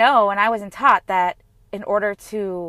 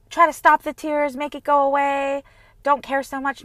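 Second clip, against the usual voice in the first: mock dismissive advice; 6 semitones higher, 4 dB louder than usual. Mimicking advice to stop crying.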